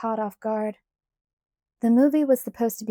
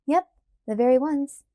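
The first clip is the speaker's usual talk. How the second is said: cheerful; 5 semitones higher, 5 dB louder than usual. A narrator's reading. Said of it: "I answered cheerfully".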